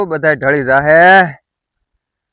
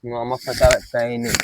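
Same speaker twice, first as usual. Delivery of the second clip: mocking echo; 7 semitones lower, 8 dB quieter than usual. Mimics the other's retort.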